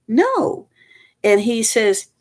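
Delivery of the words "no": sharp high surprised no; sudden high-pitched denial; startled no. Retold or imitated. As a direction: blurt it out high. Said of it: "And I went".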